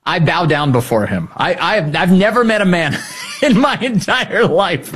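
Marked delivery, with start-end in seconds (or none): 2.0-4.9 laughing. Real, not acted. Laughs mid-sentence.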